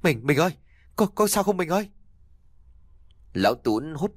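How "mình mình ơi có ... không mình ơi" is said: high, frantic plea to his wife; panicked cry. A narrator's reading. Husband panicking as his wife collapses, high frantic plea. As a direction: call out to her in panic.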